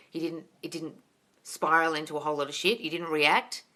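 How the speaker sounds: ordinary talk, nothing marked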